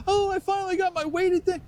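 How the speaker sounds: high gushing woman voice; crowing woman. Retold or imitated. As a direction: gush like a thrilled adult with a toy. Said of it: "this woman was crowing about it".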